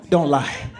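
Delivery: high loud "don't lie"; sharp loud warning; loud scold. Retold or imitated. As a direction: snap the order. Mom scolding.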